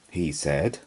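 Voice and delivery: usual speaking voice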